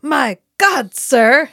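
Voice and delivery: loud, high mock-dramatic outcry; overdone dramatic line. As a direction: belt it out like a badly overacted line. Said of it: "deliver a dramatic line".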